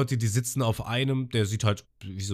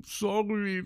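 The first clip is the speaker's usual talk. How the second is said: high, sheepish apology; Lysop imitated; 11 semitones higher, 3 dB quieter than usual. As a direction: squeak out a guilty sorry. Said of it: "Und dann Lysop so". (hohe, kleinlaute Entschuldigung)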